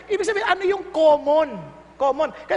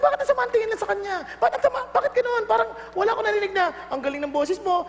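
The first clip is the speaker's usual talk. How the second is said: puzzled singer; 5 semitones higher, faster than usual. Retold or imitated. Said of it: "Sabi niya".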